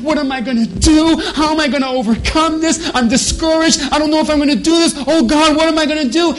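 loud, high acted panic; hammed-up frantic worrier; mock panic. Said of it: "you're afraid and panicking".

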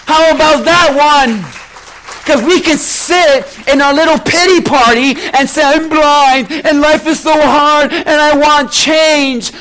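loud, driving pulpit delivery; full-force preaching. Acted. Preacher urging the congregation to follow, fervent. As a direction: preach it out to the whole church.

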